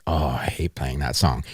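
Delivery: low, quiet grumble, imitating jaded musicians; imitated musicians' gripe, dropped low and soft; mock grumbling. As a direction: grumble it under your breath, quoting a complaint. Quoting musicians' grumble about song.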